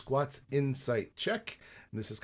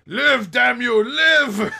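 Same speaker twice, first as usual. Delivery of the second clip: much higher, louder, frantic; desperate shout; 11 semitones higher, 11 dB louder, much slower than usual. Acted. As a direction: yell as if pounding a chest. Guard pounding chest, yelling.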